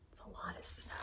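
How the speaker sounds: breathy unvoiced whisper. Real, not acted. Parent murmuring to child about the snow, whispering.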